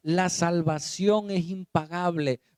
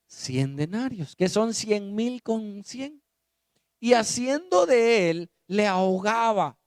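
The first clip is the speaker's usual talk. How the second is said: fiery preaching; 4 semitones higher than usual. Acted. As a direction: preach it out to the congregation. Preaching the parable.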